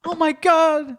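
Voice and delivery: high, slow mock gasp; mock shocked girlfriend; imitating her. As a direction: ham up her shocked oh my God. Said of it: "you were acting like me".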